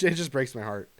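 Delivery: normal talking voice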